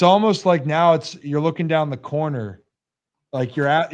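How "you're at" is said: ordinary delivery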